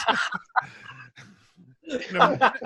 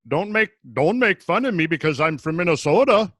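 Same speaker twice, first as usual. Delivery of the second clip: mock-offended Minnesotan; Minnesota accent. Teased by co-hosts about being from Minnesota, Minnesota accent. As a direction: protest in a played-up Minnesota accent. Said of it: "Doesn't take you long to get it back".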